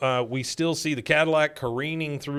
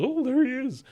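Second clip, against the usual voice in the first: excited Bill voice; 12 semitones higher than usual. Retold or imitated. Bill spotting someone.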